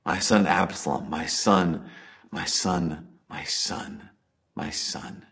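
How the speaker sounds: fading to a broken whisper of grief; a father's lament sinking into a hush; grief-stricken cry. Retold or imitated. David mourning his dead son Absalom, whispered grief. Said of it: "David cries out".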